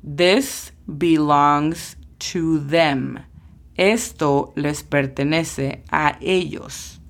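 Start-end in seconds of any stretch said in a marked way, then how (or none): 0.0-3.2 each word of This belongs to them spaced out slowly; slow model sentence. Shown as a demonstration. Teacher reads the example sentence slowly to students. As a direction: spell it out for beginners. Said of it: "Por ejemplo".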